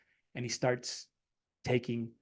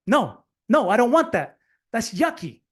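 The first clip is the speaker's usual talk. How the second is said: put-on whiny toddler refusing; 6 semitones higher, 4 dB louder, slower than usual. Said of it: "he goes".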